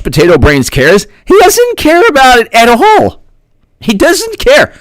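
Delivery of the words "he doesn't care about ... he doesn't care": shrill sing-song mockery, much higher; mocking high voice. Mocking the official who doesn't care, high sing-song voice. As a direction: mimic him, airy and dismissive.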